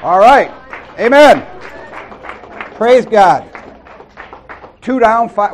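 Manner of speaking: loud, high, joyful shouts; excited praise. Real, not acted. Preacher celebrates a wall knocked down, loud excited praise. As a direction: cheer it out to the room.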